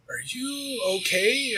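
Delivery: high, slow, drawn-out imitated question; concerned doctor. Doctor to patient on the table, imitated concern. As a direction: voice a puzzled doctor, stretched and high.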